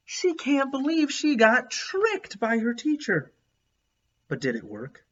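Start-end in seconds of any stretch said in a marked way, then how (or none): 0.1-3.3 playful disbelief. Real, not acted. Reader comments on picture, incredulous.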